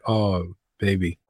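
seductive mock sigh. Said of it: "and I'm like".

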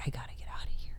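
hushed, scared whisper; urgent whisper. Retold or imitated. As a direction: whisper it under your breath. Retold thought in the shower.

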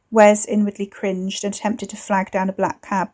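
usual speaking voice

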